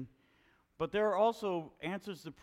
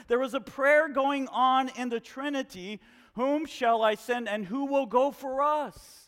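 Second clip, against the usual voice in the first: louder, higher preaching voice building in force; 9 semitones higher, 8 dB louder than usual. Acted. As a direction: preach it hard to the whole church. Preaching to the congregation.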